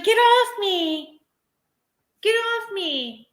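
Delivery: high, slow put-on "get off me"; mock grumpy Ziggy. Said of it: "she's like".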